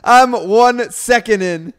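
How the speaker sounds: high, slow laughing disbelief. Real, not acted. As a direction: squeal it out through laughter. Friend laughing at the story.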